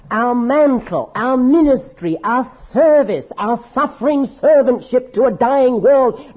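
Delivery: high, loud, slow emphatic preaching; fervent preaching. Acted. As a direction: proclaim each word with full fervour. Preaching at full force.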